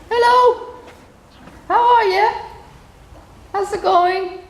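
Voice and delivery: high, loud hello; hailing greeting. Real, not acted. Speaker greets the horse in the fields, loud hailing. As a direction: hail an animal brightly across a field.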